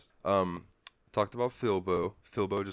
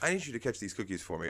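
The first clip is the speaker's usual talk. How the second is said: quick put-on goofy voice, a bit higher; lightly voiced jittery character; Snorpy's voice; 3 semitones higher, much faster than usual. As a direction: rattle it off like a paranoid oddball. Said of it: "And then he's like".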